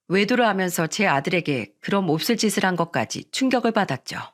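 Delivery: usual speaking voice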